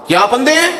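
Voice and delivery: high, rising, offended challenge; indignant high question. Retold or imitated. Old man to the ruler who stepped on him, indignant rising challenge. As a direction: toss it up at him, hurt and sharp. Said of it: "اس بزرگ نے بھی یہی جملے کہے".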